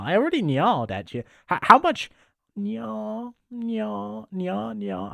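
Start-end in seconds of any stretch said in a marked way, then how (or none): 1.5-5.1 high playful catgirl nyas; cutesy nya speak. Pretend. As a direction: nya cutely at chat. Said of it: "I think I'm pretty good at nya'ing".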